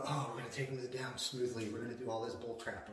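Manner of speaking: mocking. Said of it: "It's not about going".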